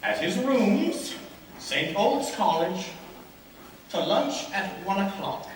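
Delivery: slow, spaced-out dictation; dictating a letter. Acted. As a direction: pause after each phrase for the writer. Dictates letter, pausing for writer.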